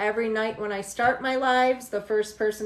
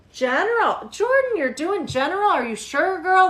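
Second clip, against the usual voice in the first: high, loud surprised question; 7 semitones higher, 6 dB louder than usual. Real, not acted. Streamer surprised by Jordan's reading pick, high and loud. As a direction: sound amazed at the choice.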